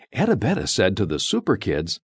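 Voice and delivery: plain reading voice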